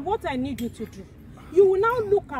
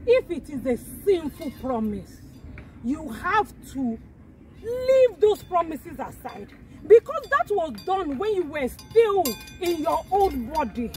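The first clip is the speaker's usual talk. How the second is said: preaching; 4 semitones higher than usual. Acted. Preaches about promises.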